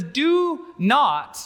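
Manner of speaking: "Do not" high, each word hit separately; forceful, drawn-out "Do not"; emphatic command. Acted. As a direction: stretch and punch "Do" then land hard on "not". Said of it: "It's emphatic".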